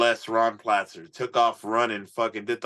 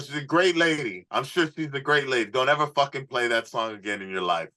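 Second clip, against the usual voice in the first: imitating Johnny; 6 semitones higher than usual. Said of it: "he's like".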